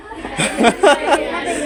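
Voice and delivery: high, light laugh. Real, not acted. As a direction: laugh at your own little joke. Laughing at own joke. (নিজের রসিকতায় হাসি)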